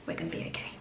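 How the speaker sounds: fading to a breathy whisper; whispered reassurance. Real, not acted. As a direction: whisper it to yourself to stay calm. Reassuring herself before hospital.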